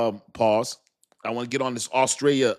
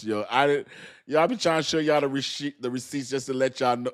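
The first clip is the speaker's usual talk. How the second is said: ordinary talk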